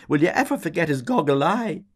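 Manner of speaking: high, loud gleeful shriek; a barmaid shrieking gleefully at a memory. A narrator's reading. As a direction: squeal it through laughter. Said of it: "shrieking Miss Kennedy cried".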